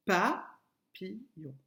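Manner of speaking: papillon counted out slowly, pa - pi - llon; syllabes comptées. Shown as a demonstration. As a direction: separate "papillon" into three slow syllables. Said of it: "Quand tu comptes avec tes doigts". (« papillon » découpé lentement en trois syllabes)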